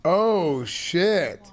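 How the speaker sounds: loud shocked oh shit; dismayed curse. Real, not acted. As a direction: blurt it out in dismay. Losing the sheriff election.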